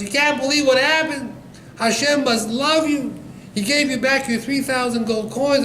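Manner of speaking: high, loud, excited cry of good news; imitated screaming wife. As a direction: shout it high, as an overjoyed wife would. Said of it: "his wife is screaming at the top of her lungs".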